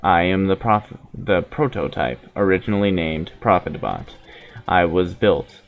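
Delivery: low, measured android speaking evenly; steady, solemn machine prophet; calm robot voice. Acted.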